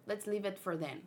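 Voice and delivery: ordinary delivery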